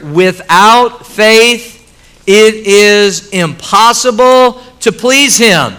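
emphatic slow reading. Real, not acted. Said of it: "that is very emphatic".

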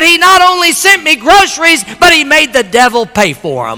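high, loud rejoicing, easing down at the end; jubilant old lady. Retold or imitated. Lady's jubilant punchline.

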